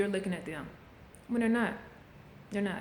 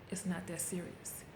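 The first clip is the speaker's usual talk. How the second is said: soft self-reassurance; 8 dB quieter than usual. Retold or imitated. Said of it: "and be like".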